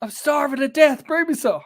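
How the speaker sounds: high whiny demand for a sandwich; mock demanding wife. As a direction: whine it like a bossy wife.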